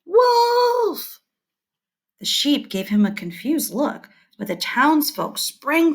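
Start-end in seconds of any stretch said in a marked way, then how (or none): 0.1-1.2 high, loud shout of alarm; a boy's pretend alarm call, much higher and louder; shouting wolf. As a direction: yell it out across to the town. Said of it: "shouted toward town".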